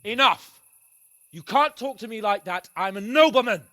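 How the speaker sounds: loud, offended noble; indignant outburst. Acted. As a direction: pull rank with a loud snap. Young lord snaps at a peasant.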